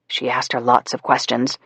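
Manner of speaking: usual speaking voice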